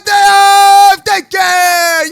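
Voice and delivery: hyped intro shout. Acted. Host opening the daycare episode, drawn-out hype shout. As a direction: bellow the episode title to the crowd.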